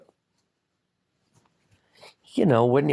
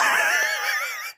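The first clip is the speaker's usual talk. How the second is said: airy burst of laughter; breathy laugh. Real, not acted. Laughs mid-sentence.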